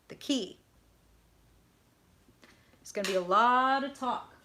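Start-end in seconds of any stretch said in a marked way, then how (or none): 2.8-4.3 LOT stretched out, louder and higher; stress on LOT. Retold or imitated. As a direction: lean long and hard on "lot". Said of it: "that's how they're putting it".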